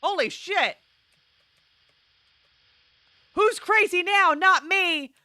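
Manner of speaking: high, loud gloating; triumphant shout. Real, not acted. Player boasting about regained sanity, high triumphant shout. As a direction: crow it at full voice.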